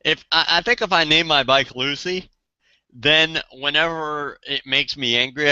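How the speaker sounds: normal talking voice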